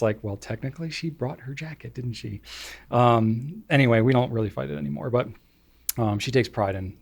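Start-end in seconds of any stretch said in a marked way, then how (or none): 0.2-2.4 much softer, under the breath; resigned aside. Retold or imitated. Parent watching daughter leave her jacket behind, quietly resigned. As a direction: concede it quietly to yourself.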